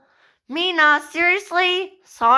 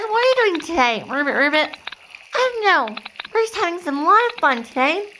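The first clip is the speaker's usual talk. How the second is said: chirpy play frog; 3 semitones higher than usual. Acted. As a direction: play an eager little frog, lifting the pitch. Playing frogs in a movie.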